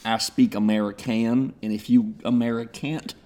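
very low, quiet Southern menace; soft, deep mock-Southern threat; backwoods tough.

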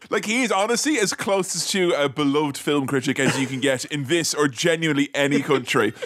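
ordinary talk

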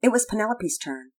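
usual speaking voice

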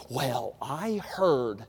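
low, slow, hushed gossip voice; gossip's opener. Retold or imitated. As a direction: drag it out like juicy gossip. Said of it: "Somebody starts a sentence like that".

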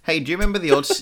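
ordinary talk

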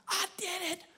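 high, jubilant testimony; imitating a thrilled waiter, high-pitched; excited convert. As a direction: burst out with the great news. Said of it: "He was so excited".

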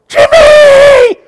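piercing, high scream of a name across a store; angry mother screaming across the market; furious shout. Retold or imitated. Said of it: "this woman shouts".

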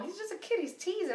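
high, dismissive mom voice; mom brushing it off. Retold or imitated. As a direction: wave it away like a tired mom.

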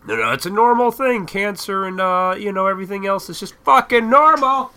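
high, building to a loud yell; mock insistence. Voicing people programmed to accept it, mock insistence. Mocking the programmed public, exasperated.

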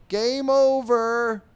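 loud, high drawn-out yell. Acted. Someone yelling from the back as the ball flies, yelled. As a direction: holler it from the back, stretching the words. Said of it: "you just hear someone yell".

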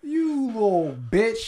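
high, loud, drawn-out mock insult; mock insulted retort. Imagining players' comeback to a reporter, mock contempt. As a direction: stretch it out high and loud, hammed-up.

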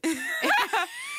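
high-pitched burst of laughter. Real, not acted.

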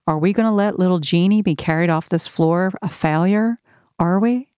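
ordinary delivery, nothing marked

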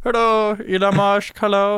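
slurred, goofy hello; silly foreign-accented billionaire caricature; bumbling impression voice; Elon Musk. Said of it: "That's how he sounds".

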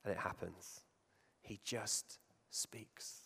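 breathy hushed whisper, very slow. Shown as a demonstration. Preacher to congregation, stressing Jesus' calm power, whispered slowly. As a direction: drop to a slow whisper, almost secret. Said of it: "Do you see the difference?".

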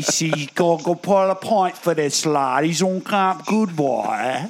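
thick mock London accent; put-on British accent, drawn out at the end; fake British accent. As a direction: ham up a British pub-lad accent.

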